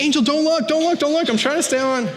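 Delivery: high-pitched mock yell at a sister; retold scream. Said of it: "I'm, like, screaming at my sister".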